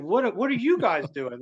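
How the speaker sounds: high, startled disbelief; shocked. Retold or imitated. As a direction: blurt it out, stunned. Shocked at girlfriend in car.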